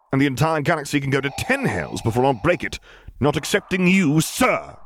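shaking with rage at his lord; angry outburst. Acted. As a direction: voice climbing high in fury.

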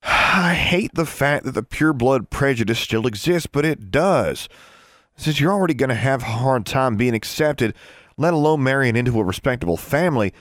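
pained, earnest reasoning; sighing. A narrator's reading. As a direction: explain with a worried sigh. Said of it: "He sighed".

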